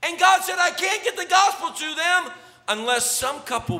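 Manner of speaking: loud, high, ringing preacher's cry; full-throated revival preaching; preaching at full force. Acted. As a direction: preach it out to the back row. Preacher building to a climax.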